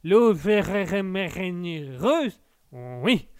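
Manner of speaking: very slow, drawn-out [name] sing-song; [name] impression. Streamer repeats [name]'s line for the chat, very slow. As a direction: drag out l'eau ferrugineuse like [name]. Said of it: "[name] le disait si bien".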